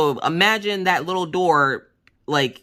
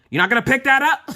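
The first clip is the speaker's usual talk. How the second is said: higher-pitched, incredulous parental yell; scare-the-kids yell; 9 semitones higher, 3 dB louder than usual. Acted. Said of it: "I will yell at them".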